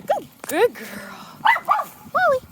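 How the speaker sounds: drawn-out, soothing praise; calm her down as she backs away; slow soothing praise. Real, not acted. Praising dog slowly.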